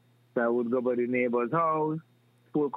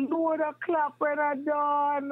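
mock exclaim; 12 semitones higher, slower than usual. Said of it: "I just said".

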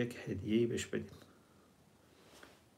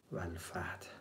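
whispered verse ending; 5 semitones lower, 12 dB quieter than usual. Acted. Preacher quoting the Quran to listeners, whispered.

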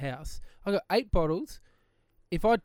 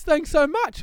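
grateful neighbour; 10 semitones higher, 4 dB louder, slower than usual. Retold or imitated. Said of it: "he goes".

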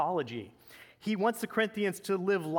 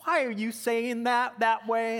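put-on high, slow Southern accent; mock Southern drawl, high and sustained; imitated Southern girlfriend; 6 semitones higher, 4 dB louder than usual. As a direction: mimic an offended Southern girlfriend, pitched up and drawn out. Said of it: "a girlfriend from the South".

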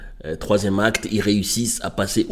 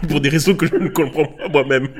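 laughing; 5 semitones higher, 3 dB louder than usual. Real, not acted. Laughs at own accent.

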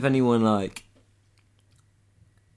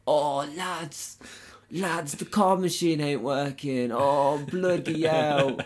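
higher, slow Essex lad voice; essex accent; 4 semitones higher, slower than usual. Retold or imitated. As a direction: play a cheeky Essex chancer.

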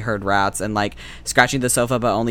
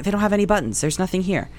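ordinary delivery

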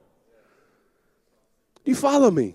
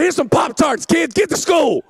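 frazzled dad; 6 semitones higher than usual. Retold or imitated. Retold frazzled dad to kids.

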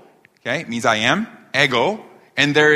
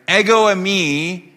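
higher, slow, drawn-out mock proclamation; mock unnatural declaration; 4 semitones higher, slower than usual. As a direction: announce it absurdly, stretching each word. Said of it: "that's not how you talk".

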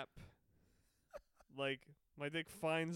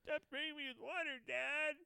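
put-on high whiny kid voice; whiny son voice; 11 semitones higher than usual. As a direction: whine like a kid getting sprayed. Playing the son being sprayed.

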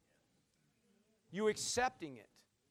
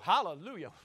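ordinary delivery

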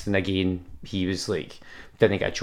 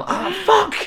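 panicked curse; 3 dB louder than usual. Retold or imitated. Panicked running for bus.

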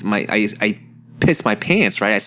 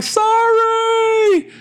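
very high, drawn-out mock holler; sing-out "sorry", pitched way up; called-out apology. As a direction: call it back over your shoulder while running. Calling back while running away.

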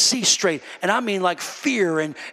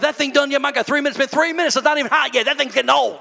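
hammed-up impatience, pitched up; impatient; 8 semitones higher, 4 dB louder, much faster than usual. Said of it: "it's gotta be quick".

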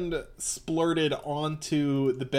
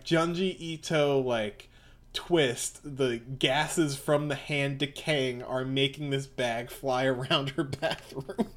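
normal talking voice

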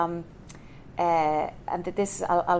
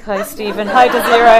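laughing greetings; 3 semitones higher, 13 dB louder than usual. Retold or imitated. Greeting colleagues while laughing.

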